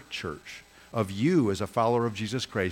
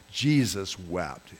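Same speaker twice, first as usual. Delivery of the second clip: slow, weighty "Jesus wept"; solemn emphasis; 2 semitones lower, much slower than usual. Real, not acted. Pastor repeats the verse solemnly to the congregation, slow. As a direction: let each word of "Jesus wept" land heavily.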